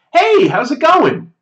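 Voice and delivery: high, bright, slow casual hello; overly familiar greeting. Retold or imitated. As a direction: greet a stranger like an old friend. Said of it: "As though I know him".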